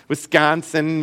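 "Wisconsin" drawn out in a broad Great Lakes accent, with a flat nasal Midwestern vowel. Shown as a demonstration. Teacher showing students his own Great Lakes accent. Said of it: "you hear a Great Lakes accent".

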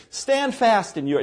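mocking dare, pitched high; sarcastic. Shown as a demonstration. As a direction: say it with open sarcasm. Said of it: "there's the sarcasm".

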